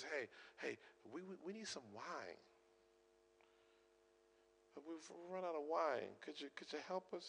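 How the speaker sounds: very quiet, hesitant request; hushed, tentative Mary; soft pleading. Acted. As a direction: ask for help softly, stumbling a little. Mary timidly asks Jesus for help.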